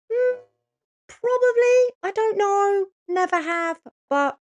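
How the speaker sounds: coy high-pitched hedging; playful high squeak. Real, not acted. Teasing self about love spells.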